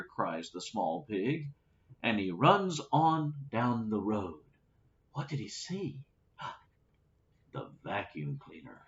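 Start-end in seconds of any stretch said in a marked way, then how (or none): 5.1-8.8 hushed aside. Real, not acted. Reader's aside to listener.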